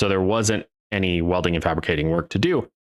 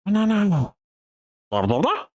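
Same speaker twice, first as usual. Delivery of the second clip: much higher squeaky banana; failed minion voice; 12 semitones higher than usual. Pretend. Vlogger attempting a minion, high and squeaky. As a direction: try a minion and miss. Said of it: "That wasn't a minion voice".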